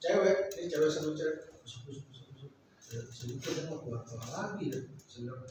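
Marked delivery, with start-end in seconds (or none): 1.6-2.5 very soft, low mock whispering; imitated furtive murmuring. Preacher mimics two girls whispering secretly in a room, furtive murmuring. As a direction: hush it right down, like secret chatter. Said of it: "tapi kusah-kusuk di kamar".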